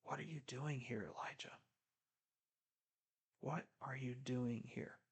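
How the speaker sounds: hushed, breathy whisper of God; gentle divine whisper to Elijah; still small voice. Retold or imitated. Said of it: "a still small voice whispering to him".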